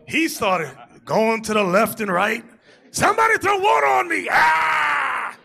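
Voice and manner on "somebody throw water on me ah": much higher, louder scream for water ending in a cry; hammed-up desperate yell. Imitating exhausted Mickey L on the climb, hammed-up yell. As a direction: wail like you're about to pass out.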